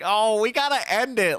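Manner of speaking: high, loud, impatient; exasperated. Retold or imitated. Urging the band to end the song, exasperated. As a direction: come on, wrap it up. Bandmate impatient with slow ending.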